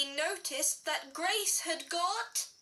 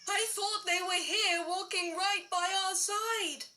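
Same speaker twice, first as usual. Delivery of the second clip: alarmed cry; 5 semitones higher than usual. Acted. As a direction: call out in sudden alarm. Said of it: "Daddy Bear cried".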